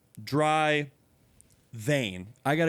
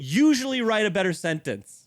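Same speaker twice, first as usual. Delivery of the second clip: louder exasperated repeat; 6 semitones higher, 4 dB louder than usual. Acted. Complaining about the question.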